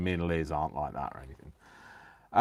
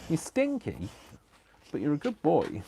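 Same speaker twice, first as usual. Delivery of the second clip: praising the dog; 8 semitones higher, 10 dB louder than usual. Real, not acted.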